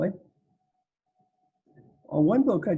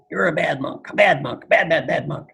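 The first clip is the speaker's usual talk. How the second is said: a touch of angry scolding, louder; 6 dB louder than usual. A narrator's reading. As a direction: scold him like a stern elder monk. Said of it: "The other monk is angry".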